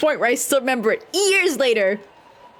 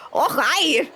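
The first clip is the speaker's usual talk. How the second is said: mock NPC greeting; 4 semitones higher than usual. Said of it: "is he supposed to be like".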